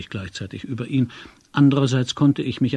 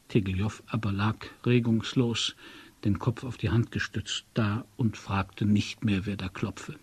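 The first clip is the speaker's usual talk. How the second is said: usual speaking voice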